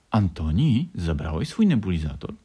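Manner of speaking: mildly worried question; lightly voiced caring grandmother; concerned. A narrator's reading. Grandmother asking about the nebulizer, a touch of concern. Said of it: "spytała z troską babcia Aniela".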